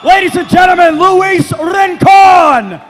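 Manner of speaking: high, loud, drawn-out name call; ring announcer. Acted. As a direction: stretch the fighter's name long. Announcing the winner to crowd.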